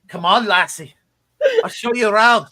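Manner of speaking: loud, high, hammed-up Scottish accent; playfully overdone Scottish tour-guide accent; terrible Scottish accent. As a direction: do Nessie in a bad Scottish accent. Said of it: "that was a terrible accent".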